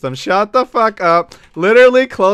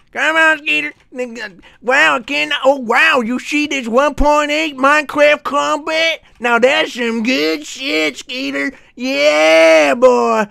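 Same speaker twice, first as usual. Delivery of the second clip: cowboy voice; 8 semitones higher than usual. Pretend. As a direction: gush like a cartoon cowboy showing off to a buddy. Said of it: "I'll do the cowboy voice".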